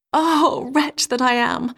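high cry of woe that eases down; a touch of a sighing lament. A narrator's reading. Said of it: "sighed she".

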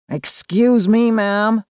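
drawling American tourist; slow, drawling American voice; American accent. A narrator's reading. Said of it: "in a drawling American accent".

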